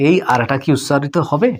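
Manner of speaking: ordinary talk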